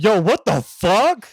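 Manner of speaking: high, loud, slow shocked yell; shocked kids. Retold or imitated. A group of kids, shocked at a smile, yelling. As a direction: react like startled teenagers. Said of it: "an entire group of kids would be like".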